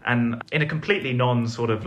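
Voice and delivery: ordinary talk, nothing marked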